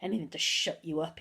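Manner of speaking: ordinary delivery, nothing marked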